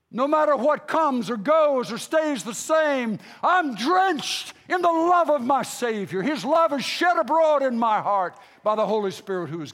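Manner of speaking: impassioned preacher testifying; forceful preaching. Acted. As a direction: declare it with full pulpit conviction.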